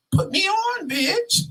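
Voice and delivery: very high, excited squeal; secretly thrilled. Acted. Silently begging a coworker to share his secret, thrilled high squeal. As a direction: squeal it like a gleeful inner plea. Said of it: "but living on the inside".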